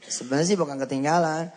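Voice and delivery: ordinary talk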